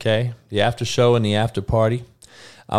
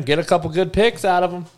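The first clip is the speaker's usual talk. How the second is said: normal talking voice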